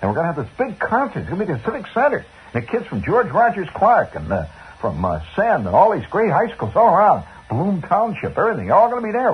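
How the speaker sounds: put-on high, fast, breathless boyish babble; eager boy, high and rushing; excited kid. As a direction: gush it all out to mom. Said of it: "I says".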